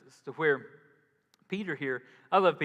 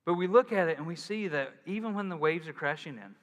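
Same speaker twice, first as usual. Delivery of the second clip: usual speaking voice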